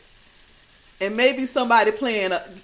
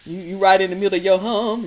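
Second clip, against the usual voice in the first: ordinary talk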